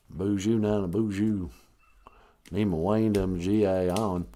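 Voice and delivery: slow northern Minnesota southerner; southern accent. Acted. Host welcoming people to the show, southern accent. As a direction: drawl a greeting in a mock southern accent. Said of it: "a southern accent in northern Minnesota".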